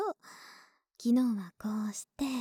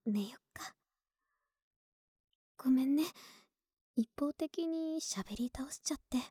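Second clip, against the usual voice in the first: sleepy whisper; 4 dB quieter than usual. Acted. Bedtime, whispering goodnight.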